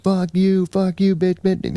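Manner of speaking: very high imitation of screaming; mock going off. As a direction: squeal out a mock rant without real volume. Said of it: "just going off on me".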